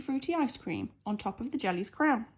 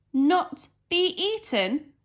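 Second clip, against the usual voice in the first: boomed; 7 semitones higher, 5 dB louder, much slower than usual. A narrator's reading. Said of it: "boomed the princess".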